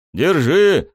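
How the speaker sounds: loud, high call; shout. A narrator's reading. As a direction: shout the word out. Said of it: "крикнул я принцессе". (крикнул)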